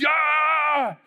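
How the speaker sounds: high, loud battle whoop; crowd war cry. Retold or imitated. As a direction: roar like a pumped-up crowd.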